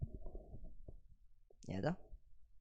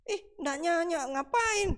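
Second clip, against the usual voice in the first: dismissive retort; 14 semitones higher, slower than usual. Pretend. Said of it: "Lalu kamu bilang".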